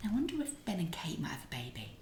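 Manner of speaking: hushed, breath-only conspiratorial whisper; private discussion. Retold or imitated. Mother confiding private baby hopes, secretive whisper. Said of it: "having this private discussion".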